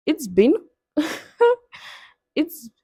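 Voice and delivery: hesitant, lilting attempt at an Indian accent. Shown as a demonstration. Trying an Indian accent for viewers, self-conscious attempt. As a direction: try an Indian accent, rusty and swinging up. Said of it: "let me try to do an Indian accent".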